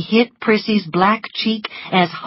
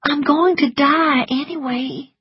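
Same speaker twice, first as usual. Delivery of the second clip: higher hopeless voice; resigned; 9 semitones higher than usual. A narrator's reading. Weak Melanie resigned.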